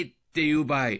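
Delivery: usual speaking voice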